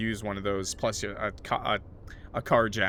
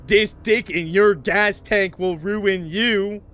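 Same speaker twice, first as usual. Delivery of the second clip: slow, loud hammed-up threat; mock aggressive; 7 semitones higher, 8 dB louder, much slower than usual. Streamer jokingly threatens chat, slow mock aggression. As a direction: lean in and growl it out as a joke. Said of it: "that was a bit aggressive".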